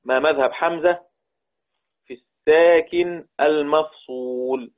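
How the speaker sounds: drawn-out phrases with a pause between; slow dictation. Real, not acted. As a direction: dictate slowly for students writing along. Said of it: "نكتب مع بعض ونركز".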